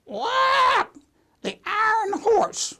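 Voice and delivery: very high, drawn-out parrot squawk; squawk, then slowly screech out the nickname; squawking bird. Acted. Bird answering the store owner, squawky parrot voice. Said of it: "And the bird says".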